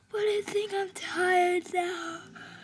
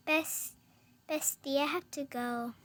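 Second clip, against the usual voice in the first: normal talking voice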